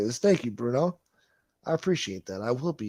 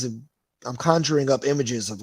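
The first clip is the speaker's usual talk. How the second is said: ordinary talk, nothing marked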